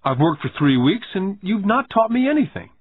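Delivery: put-on high-pitched sulky kid's complaint; acted boyish sulk; pouting. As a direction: complain with a pout. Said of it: "I said with a pout".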